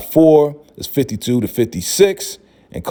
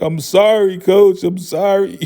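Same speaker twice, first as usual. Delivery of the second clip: apologetic Carlton; 8 semitones higher than usual. Retold or imitated. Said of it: "He's like".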